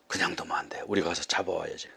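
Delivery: very low, very soft conspiratorial aside; hushed plotting. Acted. Two villagers agree to go catch the runaway, hushed. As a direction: drop right down, plotting between two pursuers.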